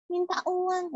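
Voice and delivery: put-on high child character voice; childlike puppet voice. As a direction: answer as a little puppet child. Puppet child mishears the adult. (suara boneka anak kecil)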